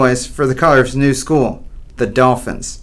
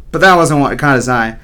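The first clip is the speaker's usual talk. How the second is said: usual speaking voice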